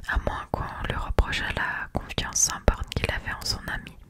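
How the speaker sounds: ordinary delivery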